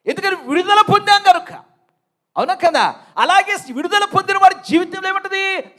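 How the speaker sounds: loud, high-pitched preacher's shout; full-force preaching. Real, not acted. Preacher rousing believers about joy in freedom, full-force preaching. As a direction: drive it out to rouse the congregation.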